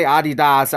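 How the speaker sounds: Adidas as AH-dee-dahs; German-style Adidas, stress off the DEE; German pronunciation. Shown as a demonstration. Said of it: "In Germany, they call it".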